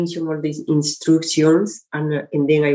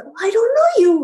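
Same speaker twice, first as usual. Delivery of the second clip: voiced girl; 4 dB louder, much faster than usual.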